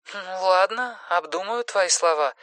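high, soft reluctant agreement; voice on the phone. Acted. Simone gives in to Erik's plea, high soft phone voice. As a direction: concede softly and high through a telephone. Симона уступает, по телефону.

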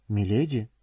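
short, uncertain question; hesitant. A narrator's reading. Young woman meeting her furious mistress on the way, hesitant. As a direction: ask it timidly, unsure of your welcome. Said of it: "с неуверенным".

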